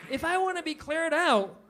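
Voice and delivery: normal talking voice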